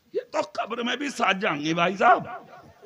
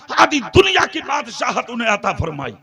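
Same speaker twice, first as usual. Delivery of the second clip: loud, impassioned sermon delivery; fiery preaching; 10 dB louder than usual. Acted. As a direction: preach it to the whole gathering. Preacher at full force.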